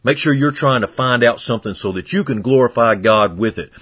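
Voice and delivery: ordinary talk, nothing marked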